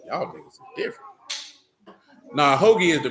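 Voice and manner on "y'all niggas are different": much softer breathy aside under the breath; whispered aside. Real, not acted. Muttering to himself about friends' odd breakfast, whispered. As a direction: say it as a private aside.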